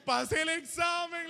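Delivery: re-enacted high, drawn-out yell of triumph; excited retold shout. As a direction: shout the good news out high, as if calling across a room. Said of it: "yo grité".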